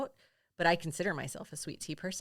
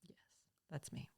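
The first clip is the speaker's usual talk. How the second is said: whispered aside; 4 semitones lower, 16 dB quieter than usual. Real, not acted. Whispered aside during list.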